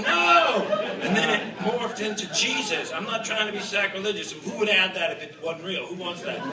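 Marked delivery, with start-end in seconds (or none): 0.0-0.7 sharp high yell; a panicked, higher yell. Retold or imitated. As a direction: shout it across the field. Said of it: "And I was yelling".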